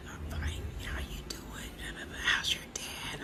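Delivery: voiceless strained whisper; hoarse whisper. Retold or imitated. Greeting the neighbour in a swollen-throat whisper. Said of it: "talking like this".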